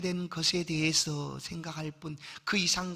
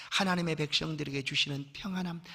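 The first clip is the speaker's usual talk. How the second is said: ordinary talk, nothing marked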